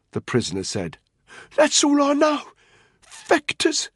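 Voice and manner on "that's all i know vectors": high, strained desperate plea; cracking voice. A narrator's reading. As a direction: insist breathlessly, voice pushed very high. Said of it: "His voice was cracking badly".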